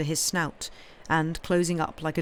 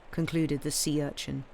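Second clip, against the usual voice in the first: ordinary delivery, nothing marked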